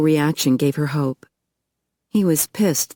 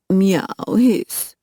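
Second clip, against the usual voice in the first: mock cat sounds in a French accent; playful French meow; 4 semitones higher than usual. A narrator's reading. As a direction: tease the women like a gleeful cat. Bouchard mocks catfight.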